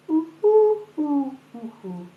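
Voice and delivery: high cooing ooh falling away; pigeon coo rhythm. Shown as a demonstration. To her audience, tapping out the pigeon's rhythm: high coo. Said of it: "listen again to the rhythm".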